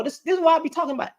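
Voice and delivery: quick, high, worked-up exclamation; fired-up outburst. Real, not acted. Ranting at a man online, quick and high. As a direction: blurt it out fast and high, fed up.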